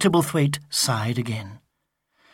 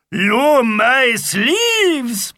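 higher, louder, very slow Viking; crying Viking; 9 semitones higher, 6 dB louder, much slower than usual. Acted. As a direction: bellow it like a triumphant Viking. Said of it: "he cried".

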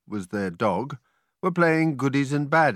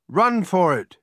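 raised, louder call to flee; urgent yell; 5 semitones higher, 5 dB louder than usual. A narrator's reading. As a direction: yell a warning, higher and louder. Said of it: "yelled Joel".

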